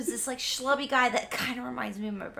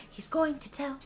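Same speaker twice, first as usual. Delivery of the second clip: ordinary talk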